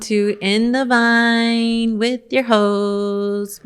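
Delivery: ordinary talk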